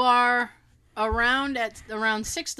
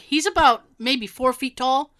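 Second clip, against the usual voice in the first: ordinary talk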